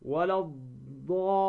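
الضالين recited with a doubled D and long A; no L before the held D in الضالين; lam assimilated. Shown as a demonstration. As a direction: say ولا الضالين as wa-lad-daallin. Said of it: "اللام الشمسية ندغمها".

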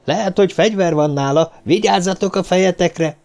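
high, pressing caution; urgent warning. A narrator's reading. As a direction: warn them all, pitched high. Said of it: "Steve zombori figyelmeztetése".

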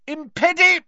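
high, loud cry, broken off; yelled spell. A narrator's reading. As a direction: shout the jinx until cut short. Harry's spell cut off mid-duel.

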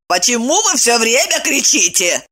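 high-pitched indignant servant. A narrator's reading. Servant rebuffs shouting master, indignant. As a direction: protest in a high, put-out voice. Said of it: "возмутилась она".

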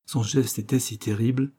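usual speaking voice

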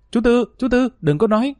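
high, frantic cry to stop him; panicked shout. A narrator's reading. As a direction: yell in alarm to silence the uncle. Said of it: "mặt mày xanh mét la lớn".

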